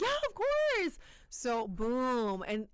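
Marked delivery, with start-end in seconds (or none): 0.0-1.0 bright eager reply. Retold or imitated. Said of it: "And I'm like".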